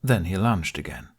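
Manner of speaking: ordinary delivery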